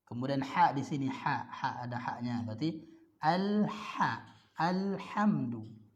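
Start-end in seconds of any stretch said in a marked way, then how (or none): 2.5-5.8 al-ha and alhamdu slow, lam sounded clearly. Shown as a demonstration. Teacher shows students the qamariyah lam, slow and clear. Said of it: "alif lam qamariyah".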